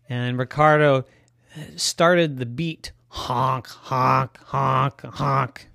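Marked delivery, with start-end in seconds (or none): 3.1-5.7 goose honking. Acted. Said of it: "He honks".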